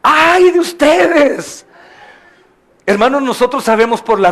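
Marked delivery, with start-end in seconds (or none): 0.0-1.7 high, slow, drawn-out anguished cry; a preacher voicing Jesus's grieving warning; exclaimed lament. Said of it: "el Señor Jesús les dice".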